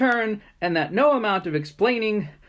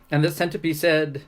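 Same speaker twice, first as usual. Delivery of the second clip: ordinary delivery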